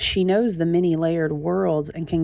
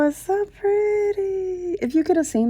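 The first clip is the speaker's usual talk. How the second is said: gushing; 13 semitones higher than usual. Real, not acted. Gushing over the book art.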